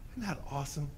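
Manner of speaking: usual speaking voice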